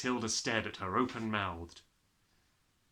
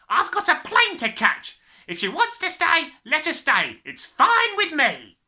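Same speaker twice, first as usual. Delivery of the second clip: very loud impatient shout; a father in a rush; 13 dB louder than usual. A narrator's reading. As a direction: snap that you're busy. Father dismissing daughter.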